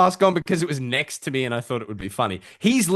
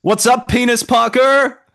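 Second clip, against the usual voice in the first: loud greeting; 12 semitones higher, 10 dB louder, slower than usual. Real, not acted. Greeting friend arriving in mask.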